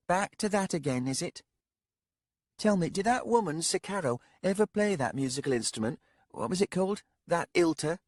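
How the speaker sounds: put-on a sharp-tongued young lady, needling the old man. Young lady voiced by narrator.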